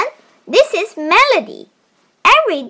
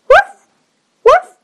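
high, loud little puppy yips; high puppy bark; 13 semitones higher, 6 dB louder than usual. Acted. Puppy barks in story.

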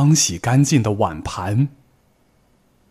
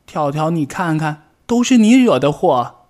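high, louder blaming cry that builds; loud scolding; 7 semitones higher, 6 dB louder than usual. Acted. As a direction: scold your friend, rising to a loud accusation. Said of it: "大声责备道".

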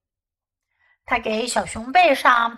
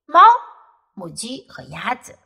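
plain reading voice

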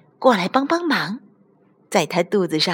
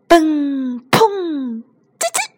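high ringing boings, then tiny squeak; bounce and squeak; 8 semitones higher, slower than usual. Acted. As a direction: imitate bouncing ending in a squeak. Bouncing and squeak sound effects. (高高的蹦砰声接一声尖细吱吱)

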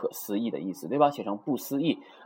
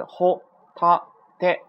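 ホタテ slow, high and louder, each kana apart; 7 semitones higher, 6 dB louder, slower than usual. Shown as a demonstration. Teacher breaks down three kana, ホタテ syllable by syllable. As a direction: punch out ホ, タ, テ with gaps between. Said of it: "有三个假名组成".

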